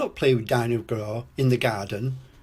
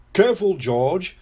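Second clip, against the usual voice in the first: higher, louder parental caution; warning; 6 semitones higher, 6 dB louder than usual. A narrator's reading. Daddy Pig warns George playing roughly, calling out. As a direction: call out a warning. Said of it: "says Daddy Pig".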